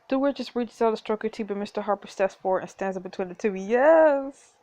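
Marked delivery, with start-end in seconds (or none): 3.5-4.5 loud, high little cheer; real delighted yes. Real, not acted. Player cheers as Harper blocks the duke, excited.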